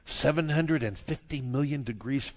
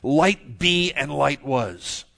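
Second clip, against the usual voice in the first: forceful, louder "Light be", slow "light was"; intensive; 3 semitones higher, 10 dB louder, much slower than usual. Acted. Preacher quotes God's creation command from the Hebrew, intensive. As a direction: punch "Light be" hard, then draw out "and light was". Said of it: "even more intensive".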